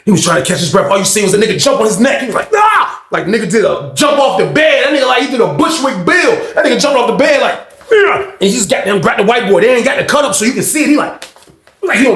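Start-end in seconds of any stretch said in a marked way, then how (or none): 2.5-3.0 much higher leaping yell; battle yell. Retold or imitated. John leaps onto the bed, battle yell. 7.9-8.3 much higher triumphant yell; battle cry. Retold or imitated. John jumps off the bed, battle cry.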